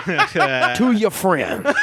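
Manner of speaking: laughing through the words; words dissolving into laughter. Real, not acted.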